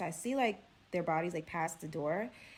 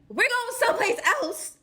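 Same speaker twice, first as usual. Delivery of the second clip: high, loud incredulous whine; hammed-up exasperated outcry; mock dismay; 13 semitones higher, 6 dB louder than usual. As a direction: pitch it way up in disbelief. Acted dismay at moving on.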